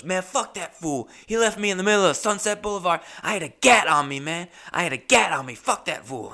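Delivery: high, drawling street voice; tattoo artist impression. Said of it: "He just goes".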